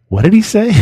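high-pitched puzzled friend; baffled question. Acted. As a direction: ask it in high-voiced bafflement. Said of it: "listening down through the hole going".

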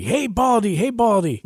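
put-on high, sing-song jeering at an old man; mock the bald prophet like a playground bully; taunting kids.

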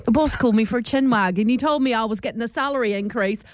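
light mock British reality-TV accent; a hint of a Love Island contestant. Love Island contestant boasting about a pay rise. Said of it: "Love Island".

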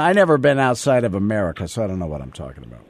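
ordinary delivery

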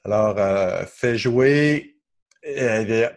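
loud, halting order to a voice assistant; acting out an unsure user, loud and faltering; hesitant voice command. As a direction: call out a command, then stall undecided. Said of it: "je sais pas trop ce que je veux".

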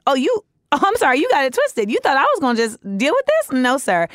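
high, sassy clapback; sassy comeback. Retold or imitated. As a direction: tell him off with attitude. Woman rebuffing harasser, retold.

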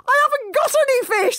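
high, fast squeaky dragon speech; speaking Dragonese. Acted. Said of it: "said Hiccup in Dragonese".

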